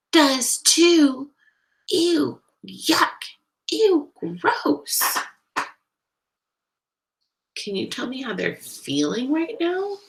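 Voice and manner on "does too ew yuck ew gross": put-on high, loud childish bickering; heated, disgusted playground retorts; kids' angry squabble. A narrator's reading. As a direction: trade insults like feuding schoolkids. Acted quarrel between two angry kids.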